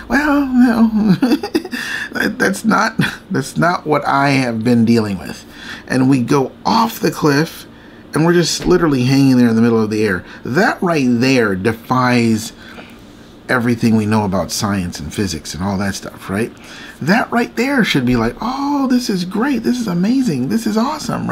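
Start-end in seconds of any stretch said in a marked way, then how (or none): 0.1-3.0 high, laughing protest; laughing denial. Real, not acted. Laughs at own ideal calm reaction. 18.4-21.3 acted amazement. Acts out ideal awed reaction.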